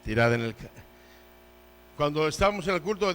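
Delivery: normal talking voice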